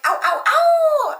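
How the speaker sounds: high, loud, slow mock wolf howl; wolf howl ringtone. Imitating the roommate's wolf howl ringtone to her listeners. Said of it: "it's a wolf howl ringtone".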